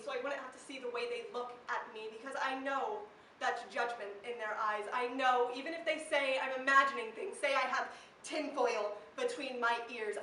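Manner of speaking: usual speaking voice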